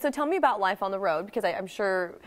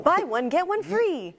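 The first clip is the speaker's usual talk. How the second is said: slow, higher pitchman delivery; infomercial voice; 9 semitones higher, slower than usual. Pretend. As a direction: sell it like a TV ad. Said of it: "Not to sound like an infomercial".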